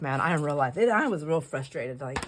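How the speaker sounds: normal talking voice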